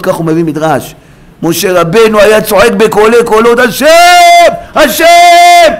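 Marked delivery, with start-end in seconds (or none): 1.4-3.6 raised, ringing declamation climbing toward a cry; building to a shout. Real, not acted. 3.6-5.7 very high, loud, stretched cry; shouting out loud. Acted.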